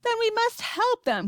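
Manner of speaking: high, urgent appeal; crying out. A narrator's reading. Boy urging a rescue, crying out. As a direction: cry it out with pitch way up. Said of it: "cried Charlie".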